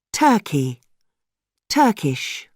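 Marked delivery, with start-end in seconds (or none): none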